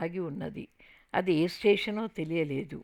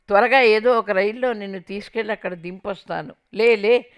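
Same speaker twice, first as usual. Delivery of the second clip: loud impatient shout, rising on లే లే; 3 semitones higher, 8 dB louder than usual. Retold or imitated. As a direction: yell at her to get up now. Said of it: "అంటూ అరిచాడు".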